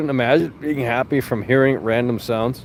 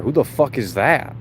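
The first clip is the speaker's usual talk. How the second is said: ordinary talk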